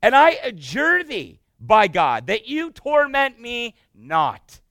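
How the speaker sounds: loud, slow, pleading cry; demon crying out. Acted. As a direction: beg loudly not to be tormented. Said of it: "He goes like this".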